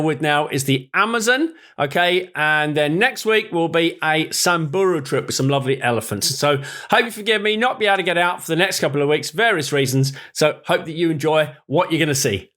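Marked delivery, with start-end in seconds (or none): none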